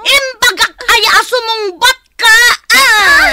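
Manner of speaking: high, loud sobbing reproach; crying scold. Acted. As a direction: scold through loud tears. Aunt wailing while scolding. (agpukpukkaw a sangsangit)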